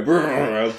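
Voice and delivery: loud, drawn-out mock growl; slow comic growl; gravelly brrrr. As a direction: imitate a gravelly voice with one long brrrr. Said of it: "he has that sort of gravelly".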